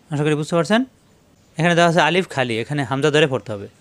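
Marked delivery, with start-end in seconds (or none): none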